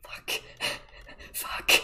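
ordinary talk, nothing marked

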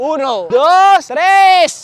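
drawn-out countdown, building to a shout. Acted. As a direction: count off slowly and burst out on the last number. Counting down to the business launch.

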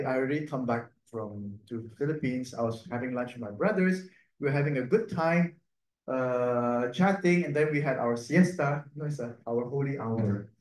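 ordinary delivery

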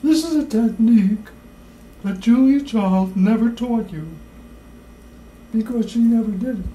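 high, loud, fluting Julia Child impression; hammed-up Julia Child cooking show voice; Julia Child accent. Shown as a demonstration. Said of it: "in my Julia Child accent".

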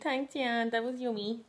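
high, cutesy voice for the dog's thanks; voicing the dog. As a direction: give the dog a squeaky little grateful voice. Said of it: "She's like".

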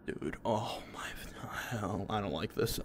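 hushed, frightened whisper; scared whisper. Real, not acted. Whispering scared while a horror game growls. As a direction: whisper it, afraid something hears.

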